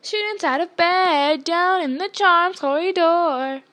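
loud, high, drawn-out holler; slow singsong alarm shout; bellowed. A narrator's reading. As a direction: bellow it like a chanting town crier. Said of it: "Peeves bellowed".

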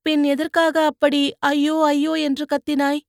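put-on young woman needling the man she pulled from the mud; teasing young woman. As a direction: poke fun at his cries like a sharp-tongued girl. Poonguzhali questions Vandiyathevan.

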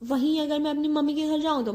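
ordinary delivery

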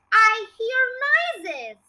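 put-on slow, drawn-out old hag screech; screeching grandma. A narrator's reading. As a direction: stretch it out like a nasty old granny. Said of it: "Grandma screeched".

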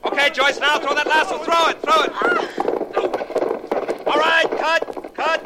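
loud and high, urging the rider on; yelling cues. Acted. Director shouts cues at Joyce during the take, loud and high. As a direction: shout across the set to the actress.

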